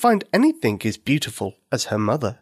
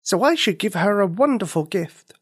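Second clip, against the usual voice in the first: put-on high, earnest little girl, worried; a child fretting over a forgotten birthday; 11 semitones higher than usual. Nikini's inner voice, story.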